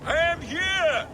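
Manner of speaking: calling back. Acted. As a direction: call your answer out across the walls. Answering the shouted challenge from afar.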